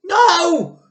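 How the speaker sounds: very high, very loud cry; a panicked shriek; Missus Cranky crying out. A narrator's reading. Missus Cranky cries out to stop her mother. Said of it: "cried Missus Cranky".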